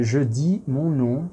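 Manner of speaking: slow and soft, word by word; slow careful example. Shown as a demonstration. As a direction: lay out the correct phrase for a student. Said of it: "é".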